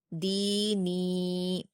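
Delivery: دینی very slow, both long ی held two beats; drawn-out recitation. Shown as a demonstration. Teacher modelling the word for pupils, vowels drawn long. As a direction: stretch both ی sounds in دینی. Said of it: "دو حرکات کھینچ کر پڑھیں گے".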